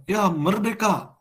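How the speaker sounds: high, drawn-out mock cry; half-hearted imitation of a patriotic shout, slow and high-pitched; imitated cheer. Papuans cheering on Independence Day, imitated shout without much volume. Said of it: "Kalau teriak merdeka ini".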